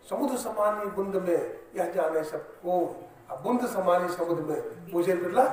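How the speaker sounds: loud, chanted recitation of a couplet; declaimed verse. Acted. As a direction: proclaim the saint's verse with force.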